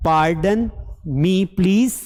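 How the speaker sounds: very slow, each word drawn out; slow model phrase. Shown as a demonstration. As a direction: spell it out slowly for learners. Models phrase slowly for learners. (धीरे-धीरे, खींचकर)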